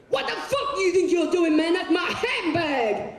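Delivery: high, strained indignant screech; outraged yell. Acted. Woman confronting customs officer over her handbag, outraged. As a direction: shriek it in mock outrage.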